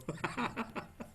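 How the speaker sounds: soft, high chuckle; quiet amused laugh to yourself. Real, not acted. Se ríe de su anécdota. (risita suave y aguda)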